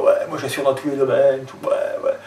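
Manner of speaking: laid-back swagger, half-whispered; cocky guy voice. Shown as a demonstration. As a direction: play the guy who's sure of himself. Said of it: "c'est le mec sûr de lui".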